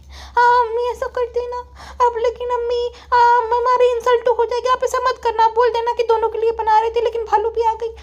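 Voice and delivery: high, insistent daughter voice; whiny daughter. Acted. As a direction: play the daughter pleading in a high pitch. Daughter figure again. (ज़िद्दी बेटी की ऊँची आवाज़)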